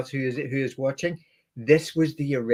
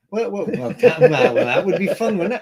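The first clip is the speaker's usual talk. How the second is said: laughing; 7 semitones higher, 8 dB louder than usual. Real, not acted. Laughing at the knife grinder idea.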